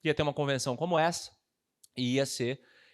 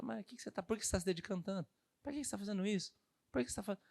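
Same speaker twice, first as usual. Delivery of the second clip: soft mocking nag; 6 semitones higher, 8 dB quieter, faster than usual. Coworker nagging softly.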